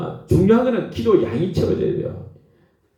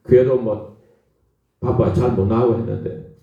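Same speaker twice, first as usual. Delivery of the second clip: ordinary talk, nothing marked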